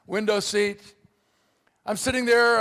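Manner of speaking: normal talking voice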